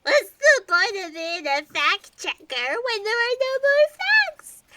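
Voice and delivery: sobbing, voice jumping up high at the end; crying despair. Acted. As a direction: wail it through the tears. Despair shredding facts.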